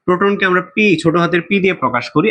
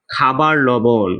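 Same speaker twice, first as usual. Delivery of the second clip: slow, drawn-out teacher answer; 4 semitones lower, much slower than usual. Shown as a demonstration. Teacher giving quiz answer to students, slow and deliberate. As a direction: spell out the answer slowly for students. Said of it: "কিসের সংকেত বলো তো?".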